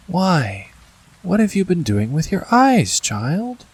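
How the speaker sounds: gently voiced old grandmother; a hint of a kindly old lady; sweet old shaky voice. A narrator's reading. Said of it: "in a sweet, but old and rather shaky voice".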